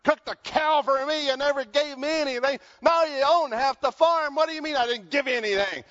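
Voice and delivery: a hint of a sulking brother; angry older son. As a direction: play the resentful older son griping at his father. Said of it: "he's mad".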